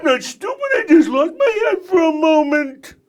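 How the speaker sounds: high, loud, fast cartoon villain whine; a squealing panicked henchman; high cartoon sidekick yelling excuses; Grounder. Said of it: "That's Grounder".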